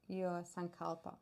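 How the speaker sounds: normal talking voice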